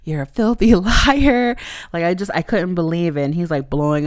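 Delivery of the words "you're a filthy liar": mock outraged accusation. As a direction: throw the accusation at him, half laughing. Retold confrontation of the cheater.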